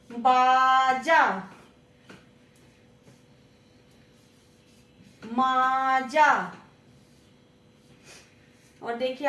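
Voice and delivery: बाजा, माजा, खाजा drawn out very slowly, half sung; teacher chanting rhymes for children; slow sing-song. Shown as a demonstration. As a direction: stretch each rhyme word like a chant. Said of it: "दोनों वर्णों पर जोर पड़ रहा है".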